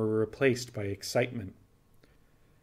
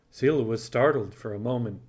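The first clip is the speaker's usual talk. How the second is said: ordinary delivery, nothing marked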